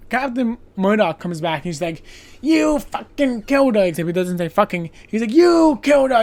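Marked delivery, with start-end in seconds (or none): 2.4-3.8 higher, louder accusing yell; angry yell. Retold or imitated. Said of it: "he starts screaming and ranting". 5.3-6.2 higher, much louder accusing yell; angry yell. Retold or imitated. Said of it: "he starts screaming and ranting".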